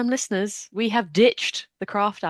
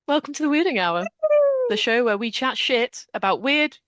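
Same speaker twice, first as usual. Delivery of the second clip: bright host opening; 6 semitones higher than usual. Acted. Restarts the show, hosting.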